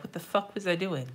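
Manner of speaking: ordinary talk, nothing marked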